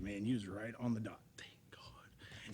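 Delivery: quietly voiced relieved officer; hushed cop voice. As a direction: drop to a near whisper as the cop. Retelling cop's relieved comment.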